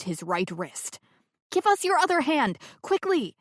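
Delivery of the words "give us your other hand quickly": high, loud urgent plea; desperate rescuer yelling down a cliff; urgent call. Acted. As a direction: shout it as you grab his wrist. Bernie grabbing falling man.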